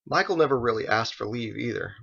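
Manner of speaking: ordinary delivery, nothing marked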